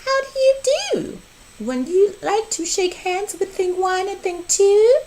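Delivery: very high, quick chirpy voices; the two Things chirping a greeting; squeaky Things. A narrator's reading. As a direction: greet them like tiny excited imps. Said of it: "They said".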